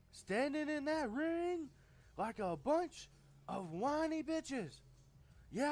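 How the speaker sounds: high, loud strutting Flair promo voice; Ric Flair impression. The booker mocks the wrestlers as Flair, loud and high. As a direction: bark it out like a cocky wrestling boss.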